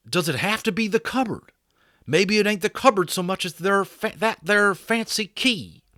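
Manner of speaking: Boone musing. Acted. Boone floats his idea.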